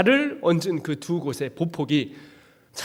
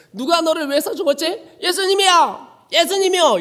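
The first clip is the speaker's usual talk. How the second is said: put-on eager child answer; 9 semitones higher, 4 dB louder than usual. Sunday school call and answer.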